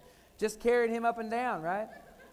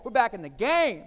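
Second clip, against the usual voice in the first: We're back in the game, louder, landing hard on game; excited announcement; 7 dB louder than usual. Retold or imitated. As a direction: say it again just like she did. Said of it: "And she said it like that".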